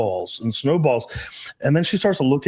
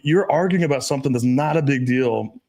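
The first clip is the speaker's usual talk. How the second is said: ordinary delivery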